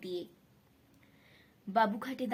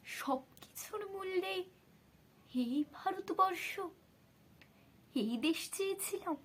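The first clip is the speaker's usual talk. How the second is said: anguished lament; 8 semitones higher than usual. A narrator's reading. Bapuji asks if this was the country wanted, anguished lament. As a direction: drop the force, keep the pitch raised in pained disbelief. Lament falls back after the outcry.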